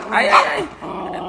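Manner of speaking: high, drawn-out ow-ow-ow; wailing little cries of pain; pained yelps. Real, not acted. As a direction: yelp as the pet attacks. Pet attacks the speaker.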